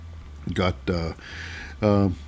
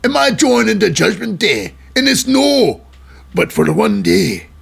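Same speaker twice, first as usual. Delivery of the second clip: much higher, put-on Scottish accent; Drew McIntyre; 6 semitones higher than usual. Host voicing the wrestler answering the big question, mock Scottish accent. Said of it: "I gotta stop doing that accent".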